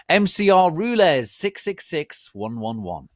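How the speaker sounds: loud, high, fast mock-teen read; gushing author's note. Reader mocking the author's note, hyped and loud. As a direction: read it like a hyper goth teen.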